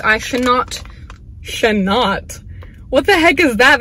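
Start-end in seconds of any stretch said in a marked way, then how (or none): none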